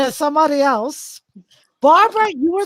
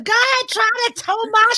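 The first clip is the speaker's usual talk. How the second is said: mock defiant yell; 7 semitones higher than usual. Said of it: "She said".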